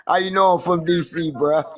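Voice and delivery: laughing. Real, not acted. Caught out, laughs at the remark.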